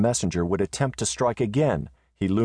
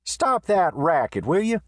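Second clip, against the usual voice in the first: high, loud, irritated call; 12 semitones higher, 3 dB louder, slower than usual. Acted. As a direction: shout from where he lies. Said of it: "heard the voice of Riata call out".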